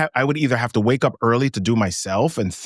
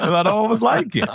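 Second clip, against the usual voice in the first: much higher, giggling through it; high laughing repeat; 8 semitones higher than usual. Real, not acted. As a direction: squeal the punchline through laughter.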